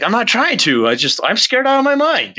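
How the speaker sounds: high-pitched, frantic defense at normal volume; retold scared protest. Retelling his answer to the medium, high and scared. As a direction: protest as if spooked, voice jumping high.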